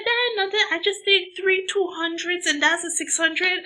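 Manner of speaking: high, loud imitated scoff; scornful boasting. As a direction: wave it away loudly in a high voice. Homegirl scoffs at stronger pills.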